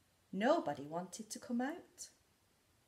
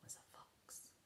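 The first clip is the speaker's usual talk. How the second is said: whisper; 5 semitones higher, 14 dB quieter than usual. A narrator's reading. Suspenseful story opening.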